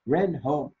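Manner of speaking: ordinary delivery, nothing marked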